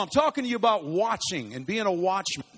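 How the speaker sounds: ordinary talk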